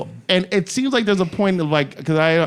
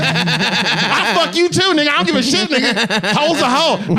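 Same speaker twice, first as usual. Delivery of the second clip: much higher pitch, laughing through it; hammed-up brash hollering; 9 semitones higher than usual. Club promoter propositioning the next man, hammed-up hollering. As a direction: imitate a brazen street come-on.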